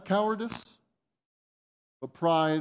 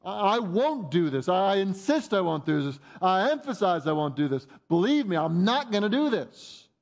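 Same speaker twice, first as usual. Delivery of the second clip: higher-pitched, adamant protest; acted-out heated insistence; arguing insistently; 5 semitones higher than usual. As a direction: argue back as Peter, hammering each refusal. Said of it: "He's, like, arguing with Jesus".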